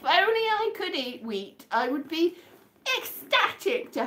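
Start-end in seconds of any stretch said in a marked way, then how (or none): none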